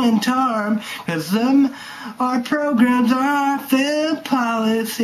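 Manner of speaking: high, loud mock political rant. Vlogger hamming up his dad's political rant, high and loud. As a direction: bellow it like a hammed-up rant. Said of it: "you like my song?".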